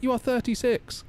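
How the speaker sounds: high firm imitation of his wife; hammed-up wife shutting him down. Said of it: "She's like".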